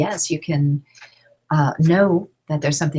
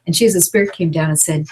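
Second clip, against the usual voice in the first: normal talking voice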